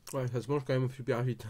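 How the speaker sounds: chewing between words; speaking around food; mouth full while talking. Real, not acted. Seen 4: Bit the wrap at -1.0, talks with food in mouth.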